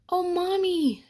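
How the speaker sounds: put-on high, bright child calling her mother; a small girl tugging at Mommy, voice up high; excited little girl. A narrator's reading.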